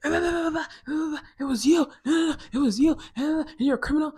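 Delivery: mock shouting blame at the rival; accusing yell. Families accusing each other.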